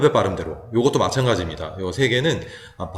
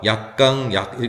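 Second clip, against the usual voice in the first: "약강, 약" with 강 raised in pitch; weak-strong-weak stress; slower than usual. Shown as a demonstration. Teacher beating out the word's stress, weak-strong-weak. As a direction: punch 강 in "약강, 약", dropping both 약. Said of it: "이 경우는 액센트가 없게 되죠".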